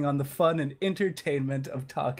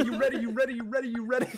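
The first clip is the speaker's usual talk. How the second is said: hype man; 7 semitones higher, much faster than usual. Pretend.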